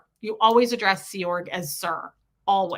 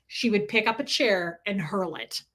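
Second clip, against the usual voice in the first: usual speaking voice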